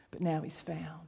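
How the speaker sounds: whispered, tender ending; soft whisper. Acted. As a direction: let it fall to a hush. Father ends his gentle reply.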